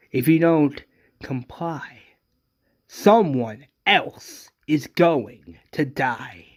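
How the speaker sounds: slow, deliberate villain's warning; emphatic threat. Acted. Governor threatens another death, slow emphatic menace. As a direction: spell out the threat word by word.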